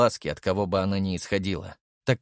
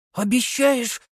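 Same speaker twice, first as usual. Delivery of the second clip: put-on little boy asking; 12 semitones higher, 3 dB louder, slower than usual. A narrator's reading. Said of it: "спросил мальчик".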